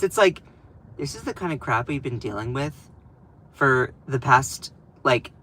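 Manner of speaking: ordinary talk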